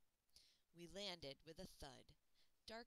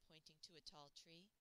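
plain reading voice

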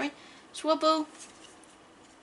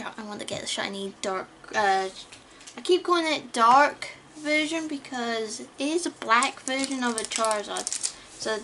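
usual speaking voice

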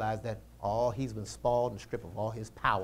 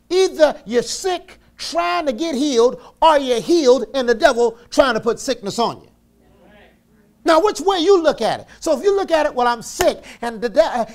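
loud, high, driving sermon voice; preaching at full force; 17 dB louder than usual. Acted. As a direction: preach it hard to the congregation.

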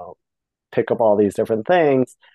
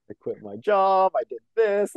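ordinary delivery

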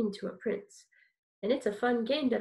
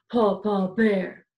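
lower, louder big bear voice; Papa Bear voice; 5 semitones lower, 6 dB louder than usual. Shown as a demonstration.

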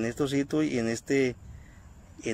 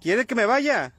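higher, louder tense questions into the dark; urgent calls to a spirit; 8 semitones higher, 3 dB louder, slower than usual. Real, not acted. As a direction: demand an answer from the ghost.

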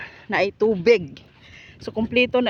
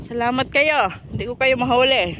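raised, louder playful taunt; mock threat; 3 semitones higher, 7 dB louder than usual. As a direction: call it out to birds that got away. Mock threat called at birds.

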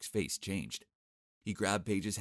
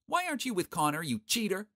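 put-on taunting kid; 12 semitones higher, 4 dB louder than usual. Boy sent to taunt.

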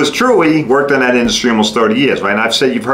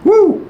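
mock whoop. Mock cheer.

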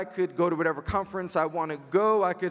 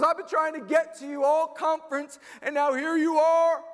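high, insistent fan voice; mock-eager young lady; excited young woman; 9 semitones higher, 4 dB louder than usual. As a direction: imitate her pitched way up, louder at the end. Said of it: "She goes".

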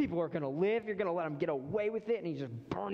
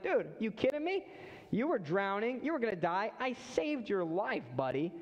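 much higher pitched hammed-up scolding; mock scolding; 5 semitones higher than usual. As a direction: scold him like an exasperated buddy. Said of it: "he could have smacked him and been like".

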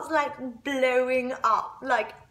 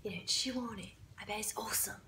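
soft goading; 2 semitones lower, 15 dB quieter, faster than usual. Retold or imitated. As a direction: egg someone on under your breath. Acted egging-on of the girl.

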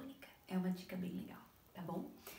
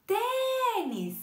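"tênis" with a long, loud, high "tê"; called out, first syllable stressed; 10 semitones higher, 7 dB louder than usual. Shown as a demonstration. Teacher calling tênis to show pupils its strong syllable, drawn-out stress. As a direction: call out "tênis", stretching the "tê". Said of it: "se a gente fosse chamar".

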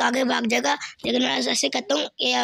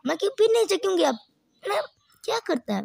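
exasperated outburst; 8 semitones higher, faster than usual. Real, not acted. Annoyed at own mistake.

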